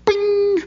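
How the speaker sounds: a sudden high "Bing" like a shooting star zipping past; ping it out high and quick as a star streaks by. Retold or imitated. Preacher imitates a shooting star with a high bing.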